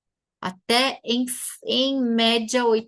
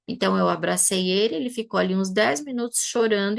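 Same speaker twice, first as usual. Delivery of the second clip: ordinary talk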